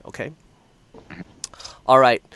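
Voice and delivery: normal talking voice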